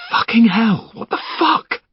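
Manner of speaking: can't believe who it is; stunned. Acted. Finn recognising Henry, stunned.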